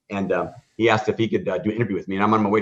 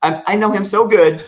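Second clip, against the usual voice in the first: much higher and louder, tumbling out; excited disbelief; 10 semitones higher, 8 dB louder than usual. Retold or imitated. Reliving the call from the former customer, excited. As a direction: gush it, overwhelmed with excitement.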